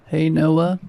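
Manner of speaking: croaking demon DJ. Acted. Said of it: "the voice croaked".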